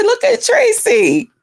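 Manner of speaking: high, drawn-out tease; teasing. Retold or imitated. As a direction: sing it out slowly, teasing. Teasing call to friend.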